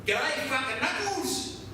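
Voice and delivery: high, slow mocking greeting; cheeky bloke. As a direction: drag out the insult, high and slow. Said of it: "So the bloke goes".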